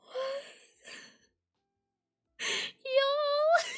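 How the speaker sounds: very high, choked-up gratitude; fighting back tears. Real, not acted. Streamer overwhelmed by viewers' support, on the verge of crying. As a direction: squeak it out while tearing up. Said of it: "I'm trying not to cry".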